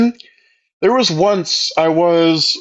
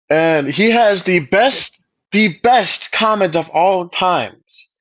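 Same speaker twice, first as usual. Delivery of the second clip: ordinary delivery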